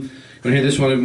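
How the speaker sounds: ordinary talk, nothing marked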